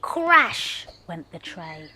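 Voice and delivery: ordinary delivery, nothing marked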